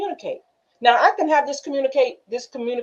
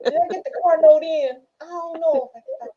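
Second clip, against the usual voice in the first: distracted inner thought; 7 semitones higher, 3 dB louder than usual. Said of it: "That's what's going through their mind".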